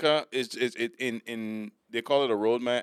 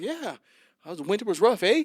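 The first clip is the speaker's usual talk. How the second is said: high-pitched mock Canadian small talk; friendly Canadian; 10 semitones higher, 5 dB louder than usual. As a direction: play the chatty Torontonian. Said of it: "and they were like".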